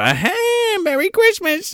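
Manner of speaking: very high, put-on holiday greeting; high-pitched mock Christmas cheer; jokey Grandpa voice. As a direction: squeal it as a comic Grandpa punchline. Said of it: "He shoulda been like".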